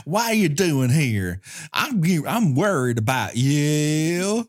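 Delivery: mock Southern drawl, last words slowly stretched; thick Southern drawl. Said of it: "that drawl is so thick you can barely understand her".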